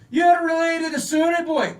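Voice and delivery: put-on loud, high Scottish accent shout; a lightly done angry Scottish accent; broad Scottish accent. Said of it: "with a thick Scottish accent".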